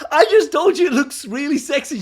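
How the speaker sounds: mock-exasperated husband protesting; baffled protest. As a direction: protest in disbelief. Retold baffled protest to wife.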